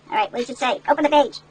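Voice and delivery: usual speaking voice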